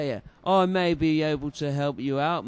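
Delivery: ordinary talk, nothing marked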